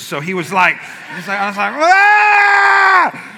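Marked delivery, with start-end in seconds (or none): 1.7-3.3 long, high, loud effort scream. Retold or imitated. Lifter pushing on after coach's rebuke, screaming. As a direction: mimic screaming your way through a lift. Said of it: "And I started screaming".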